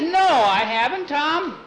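high, loud protest; high-pitched insistent denial; indignant denial. Acted. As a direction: protest it hotly at your brother. Boy denies dreaming.

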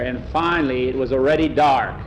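usual speaking voice

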